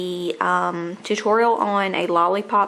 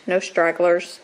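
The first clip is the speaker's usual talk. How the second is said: ordinary talk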